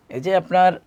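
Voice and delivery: normal talking voice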